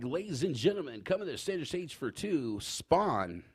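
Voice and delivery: nervous rookie DJ calling the next dancer up; DJ announcer voice. Retold or imitated. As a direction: announce her to the stage like a strip club DJ. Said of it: "do my little D J voice".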